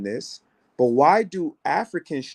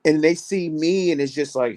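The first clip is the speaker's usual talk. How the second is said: ordinary delivery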